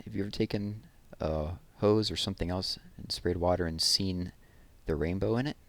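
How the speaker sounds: ordinary delivery, nothing marked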